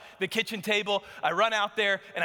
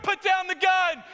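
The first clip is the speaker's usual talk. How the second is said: loud, higher barked command; police shout; 10 semitones higher, 3 dB louder than usual. Retold or imitated. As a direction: shout down the man holding a gun. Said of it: "They're like".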